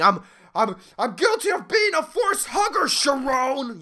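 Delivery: high mock scream. CEO screaming his guilt at Sharon, high mock yell. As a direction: scream the confession at her. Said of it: "so maybe he's screaming".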